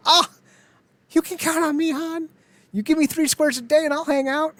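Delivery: high-pitched, hammed-up eagerness; volunteer eagerly in a squeaky, put-on high voice; over-eager sidekick begging to join; mock eager pleading. Acts out eager volunteering to Han.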